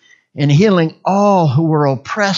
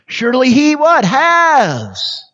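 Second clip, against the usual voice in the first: "Has" high, long and falling; stress on Has; 6 semitones higher, slower than usual. Shown as a demonstration. Preacher going through the verse word by word, stressing "Has". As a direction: build up to "Has" and stretch it out. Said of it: "Just emphasize each word".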